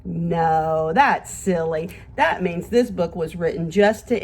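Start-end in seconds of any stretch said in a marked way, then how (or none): none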